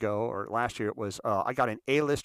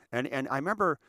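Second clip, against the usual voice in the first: normal talking voice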